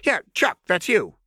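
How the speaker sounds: put-on high, sing-song playful girl; high chirpy menace; manic Jinx voice. A narrator's reading. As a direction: tease the hostage brightly. Jinx voice continues.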